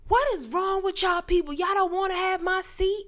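high-pitched mock indignation; imitating loud man. Bus rider rebuking passengers who refuse his seat, high mock indignation. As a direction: pitch it way up, as if scolding everyone. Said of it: "there was this loud-ass man".

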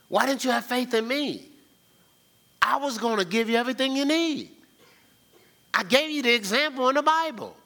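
high-pitched, exasperated questioning; preacher acting out a divine reproach; Christ. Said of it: "you know what Christ is saying".

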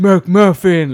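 loud, high exclaimed McMuffin; shouted movie quote. Retold or imitated. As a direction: belt it out like the famous film line. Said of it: "Love that line in Superbad".

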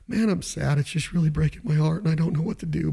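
a lightly hammed-up heartbroken complaint; mock sadness. Caller moans about rivals sounding better, mock sad.

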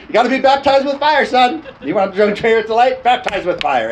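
high, loud fiery dad; preachy dad voice. Retold or imitated. Dad quoted preaching at son.

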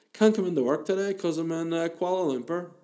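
high-pitched lying phone excuse; imitating a feeble caller's excuse; mock sick-call excuse. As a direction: pitch it up like a bad liar calling work. Second fake sick call.

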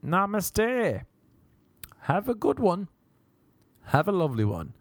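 high, sing-song polite brush-off; airy fake pleasantness; mock serene farewell. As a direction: sweetly dismiss an argument, pitched high. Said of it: "That's why it's better to be like".

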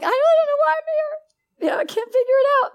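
very high, fast, tearful wail; high crying rush of words; losing it, crying. Retold or imitated. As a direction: replay the moment of falling apart in tears. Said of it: "and I was losing it".

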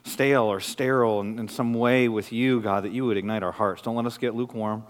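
soft, low pastoral prayer. Acted. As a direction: pray quietly over the congregation.

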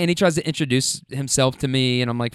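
ordinary delivery

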